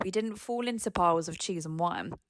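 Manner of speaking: ordinary talk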